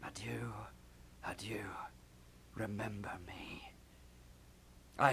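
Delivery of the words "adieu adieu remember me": soft whispered echo. Acted. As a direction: repeat the ghost under your breath. Hamlet echoes the ghost.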